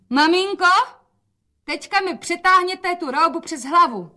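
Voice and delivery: put-on a young girl calling her mother; young daughter voice. A narrator's reading. Rozetka volá na matku. (vysoký dívčí hlas)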